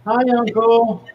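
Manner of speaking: a captured nephew calling out to his uncle; nephew's voice. Acted.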